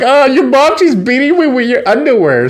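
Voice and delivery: high-pitched, put-on alarm; hammed-up high complaint; mock distress. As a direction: cry out in mock protest. Girl complains while being beaten.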